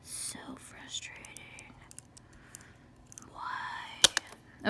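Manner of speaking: breathy slow whisper of despair; real exasperated whisper; frustrated whisper. Real, not acted. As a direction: whisper it to yourself, defeated. Frustrated at own work.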